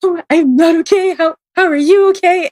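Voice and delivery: frightened reader answering the client's question; scared. Retold or imitated. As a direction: squeak it out in fright. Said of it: "And I was like".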